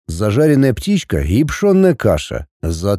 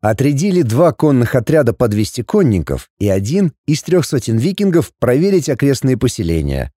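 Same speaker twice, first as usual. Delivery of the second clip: plain reading voice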